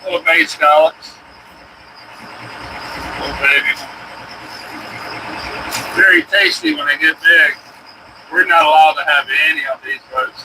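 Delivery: ordinary delivery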